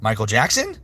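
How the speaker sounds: ordinary talk, nothing marked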